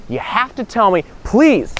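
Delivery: high-pitched begging; pleading. Acted. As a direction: beg him, pitch rising to the last word. Guy begs the monk to tell.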